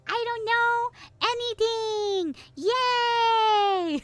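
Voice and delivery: very high, long drawn-out mock cheer; high-pitched, drawn-out fake celebration. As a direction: squeal it slowly, stretching out each word. Mock cheer at knowing nothing.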